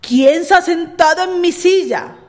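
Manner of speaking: papa bear's big loud question; angry papa bear exclaiming; big booming voice. A narrator's reading. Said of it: "con gran vozarrón".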